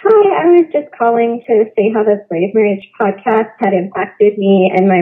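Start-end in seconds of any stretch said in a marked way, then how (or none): none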